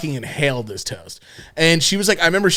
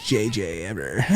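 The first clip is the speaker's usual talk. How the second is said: Superbad girl quote; 6 semitones lower, 7 dB quieter than usual. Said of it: "like, in Superbad where she's like".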